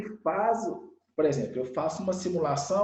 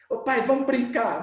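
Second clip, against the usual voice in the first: put-on higher, louder pleading kid; eager child; 6 semitones higher, 4 dB louder than usual. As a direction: pester dad to play. Child begging dad to play. (criança animada pedindo ao pai)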